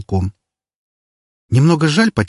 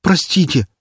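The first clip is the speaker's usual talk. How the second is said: high, startled apology; frightened; 8 semitones higher than usual. Acted. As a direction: flinch and blurt it out. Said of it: "он весь сжался и задрожал".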